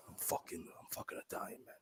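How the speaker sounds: whispered, wounded insistence; hushed retold protest. Offended friend insisting on being Italian, imitated whisper.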